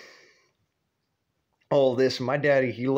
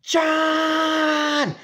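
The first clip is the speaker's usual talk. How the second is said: high piercing yell across the house; screaming John; 5 dB louder than usual. Retold or imitated. Grandma screaming for her son from the kitchen, piercing yell. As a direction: scream his name at the top of your lungs. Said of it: "she's screaming at the top of her lungs".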